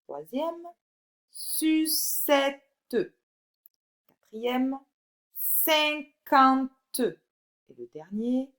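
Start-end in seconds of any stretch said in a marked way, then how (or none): 1.3-2.6 sucette split as su-ce-tte, each part held high; syllable by syllable. Shown as a demonstration. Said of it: "dans quelle syllabe tu entends le son S". 5.3-7.0 cinquante split as cin-quan-te, high and loud; syllable by syllable. Shown as a demonstration. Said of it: "dans quelle syllabe tu entends le son S".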